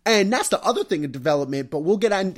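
normal talking voice

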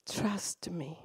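soft whispered reassurance; whispered trust me. Retold or imitated. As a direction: whisper it close and gentle. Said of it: "and he said to me".

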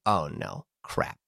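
low, hushed mock dismay; quietly sinking realization, acted out; retold dismay. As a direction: mutter it low to yourself, defeated. Told to sit, needing bathroom.

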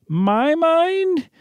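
high, bewildered wife's voice; Janet's voice. Acted. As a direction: echo it back high and baffled. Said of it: "the role you were born to play".

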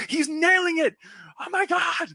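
high, loud mock awe; acted shriek of admiration; hammed-up amazement. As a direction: exclaim like an overwhelmed fan, voice shooting way up. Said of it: "You're just like".

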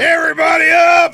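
high, loud barked wake-up call; drill sergeant shout. Pretend. Mock drill sergeant waking campers.

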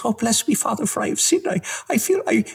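tiny, very high, timid voice; tremulous nun. Acted. Little nun starting her confession, tremulous. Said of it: "tremulous nun goes into confession".

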